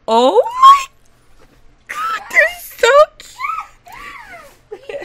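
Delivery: high, drawn-out laughing squeal; excited squeal. Real, not acted. Gamer gushing over cute toddlers, excited squeal. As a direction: squeal it, loud and overcome with delight.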